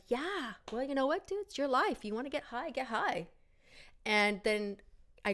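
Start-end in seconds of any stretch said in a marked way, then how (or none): 0.1-3.3 easygoing agreement. Retold or imitated. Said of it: "was like".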